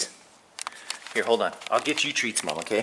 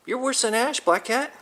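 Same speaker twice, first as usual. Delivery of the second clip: talking to a cat; 13 semitones higher than usual. Real, not acted.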